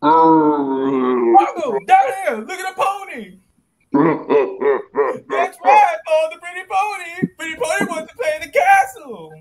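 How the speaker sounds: high calling voice, loud; calling from far away. Acted. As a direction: call out high to lure the giant. Luring giant with pony.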